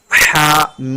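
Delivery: Quranic letters drawn out very slowly; tajweed recitation with long madd. Shown as a demonstration. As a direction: chant each letter long and measured. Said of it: "मीम को तीन अलिफ के बराबर खींचेंगे".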